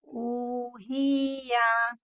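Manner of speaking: ऊहिया with ऊ held two counts and या quick; very slow held opening vowel; long u held. Shown as a demonstration. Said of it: "इसको हमें टू second पढ़ना है". (ऊ को दो सेकंड खींचें)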